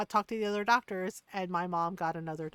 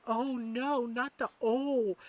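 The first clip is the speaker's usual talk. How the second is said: higher-pitched mock-horrified groaning words; retold dismay; 4 semitones higher, 4 dB louder, slower than usual. Daughter hearing about the catheter mistake, mock dismay. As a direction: wince aloud, pitched up and drawn out.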